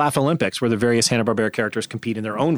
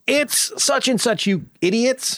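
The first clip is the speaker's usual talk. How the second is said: mock yelling; 10 semitones higher than usual. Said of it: "yelling on a train".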